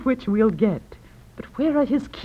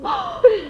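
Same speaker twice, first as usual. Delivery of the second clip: sharp high gasp of shock. Acted. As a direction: catch your breath in sudden surprise. Wife startled by husband's death.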